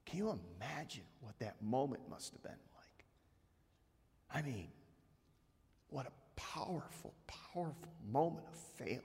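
hushed whisper. Real, not acted. Preacher whispers to the congregation about failure. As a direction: breathe it out as a whisper. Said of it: "whispered reflection on failure".

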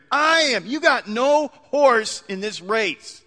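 high, loud, indignant outburst; angry shout. Acted. Car owner confronting the driver who rammed him, angry shout.

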